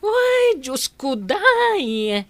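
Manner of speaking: high, sudden cry of disbelief; shocked exclamation. Acted. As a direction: gasp it out as if scandalized. Shocked reaction to the request. (nakigtot a panagikkis)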